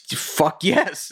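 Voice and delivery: high, excited yes; thrilled. Retold or imitated. Fan answering [name] at the door, high and thrilled. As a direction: burst out delighted.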